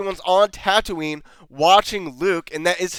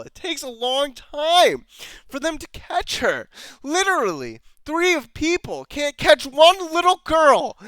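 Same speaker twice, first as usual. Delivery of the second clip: higher, louder disbelief; higher incredulous rant; 7 semitones higher, 3 dB louder than usual. Real, not acted. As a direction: pile on the outrage at the chase. Incredulous ranting retell of chase.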